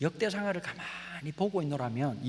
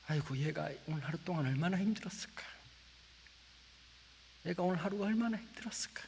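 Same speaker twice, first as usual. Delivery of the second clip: soft whispered pity; tender whisper; 4 dB quieter than usual. Retold or imitated. Father watching his sleeping daughter, tender whisper. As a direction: breathe it over a sleeping child.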